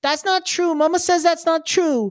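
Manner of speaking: put-on high-pitched little-kid protest; Iggy. Little brother disputing alligator tale, high protesting voice. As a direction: object high, like an upset small child. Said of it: "Iggy goes".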